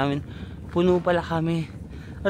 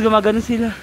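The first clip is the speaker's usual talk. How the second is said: ordinary talk, nothing marked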